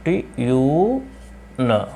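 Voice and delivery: ट्यून stretched very slowly and softly, tyoon; drawn-out pronunciation. Shown as a demonstration. Teacher slowly modelling the word for students. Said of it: "ये हो जाएगा ट्यून".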